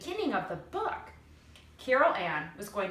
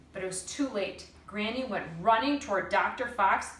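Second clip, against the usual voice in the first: plain reading voice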